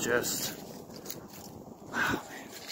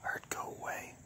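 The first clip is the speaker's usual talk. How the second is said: whisper; 12 dB quieter than usual. Real, not acted. Whispering on a ghost hunt.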